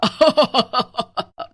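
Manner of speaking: long, loud witch cackle. Acted. Witch delights in her burger plan, loud long cackle. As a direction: let the gloating burst into a big cackle. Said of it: "और ज्यादा जोर से हंसने लगती".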